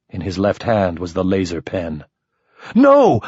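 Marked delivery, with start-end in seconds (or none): 2.7-3.2 loud, high alarmed shout; sudden high shouted "No"; yell. A narrator's reading. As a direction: yell to stop him. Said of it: "I yelled".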